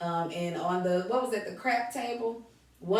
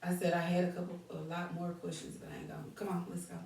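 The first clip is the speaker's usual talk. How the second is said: very soft, low-energy mumbled aside; drowsy and quiet; sleepy; 3 semitones lower, 13 dB quieter, faster than usual. Real, not acted. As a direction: talk as if tired, well under your usual volume. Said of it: "Tell us you sleepy".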